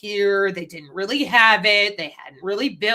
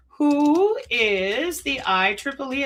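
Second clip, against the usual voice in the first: slow game show host question; quiz show host; 4 semitones higher, slower than usual. Pretend. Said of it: "I feel like we're playing Jeopardy again".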